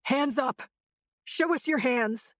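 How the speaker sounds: high commanding shout. A narrator's reading. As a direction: shout the order into the car. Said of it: "I shouted into the Jeep".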